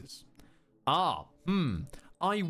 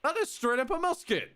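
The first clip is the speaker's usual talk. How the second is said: excited; 9 semitones higher than usual. Real, not acted. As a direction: cheer it out, overjoyed. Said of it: "excited about the musket".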